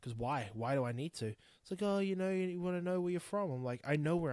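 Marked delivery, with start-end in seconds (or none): none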